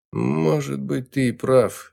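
usual speaking voice

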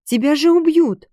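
high, loud cry of alarm; alarmed gasp. A narrator's reading. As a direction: exclaim in fright for someone's life. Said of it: "ахнула Марисоль".

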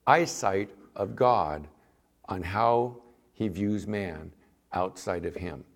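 normal talking voice

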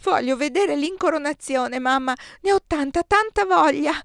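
put-on a little boy crying for what he wants; child sobbing to mother. A narrator's reading.

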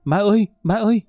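put-on high, wailed child's call for mother; crying child. A narrator's reading. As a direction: imitate a small child crying out in fright. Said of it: "làm nó khóc ré lên".

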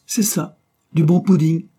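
s'écrie fort et haut, ravie; lance-le fièrement à madame; cri joyeux. A narrator's reading. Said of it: "cria-t-elle". (cri aigu et enjoué de servante)

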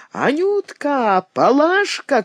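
put-on high call across the house to the girls. A narrator's reading. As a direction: hail the servant girls by name. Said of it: "кричала старуха своим девкам".

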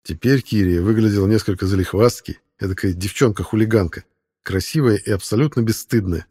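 ordinary delivery, nothing marked

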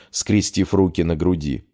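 plain reading voice

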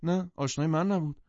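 high-pitched quick denial; denying. A narrator's reading. Wife denying the man was her acquaintance, protesting. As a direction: push the claim away, protesting. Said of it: "دیزی انکار کرد".

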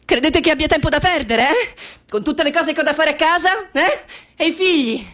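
high, loud, exasperated protest; indignant retort. Retold or imitated. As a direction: throw it back at them, outraged. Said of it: "Gli avrei detto". (protesta indignata, alta e forte)

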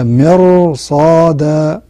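إرصادا said slowly, R heavy before the صاد; slow recitation of إرصادا; heavy R demo. Shown as a demonstration. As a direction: draw out إرصادا with a thick R. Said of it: "راء ساكنة".

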